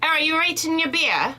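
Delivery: condescending English accent. Shown as a demonstration. Co-host retrying the Englishwoman's question, condescending English accent. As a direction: look down on them in an English accent. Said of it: "no, it wasn't condescending".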